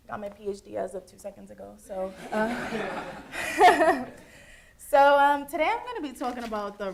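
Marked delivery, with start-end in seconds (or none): 2.5-4.6 big real laugh, falling away; loud burst of laughter. Real, not acted. Laughing at her own joke to the class, a loud real laugh. Joke about getting PhD just now.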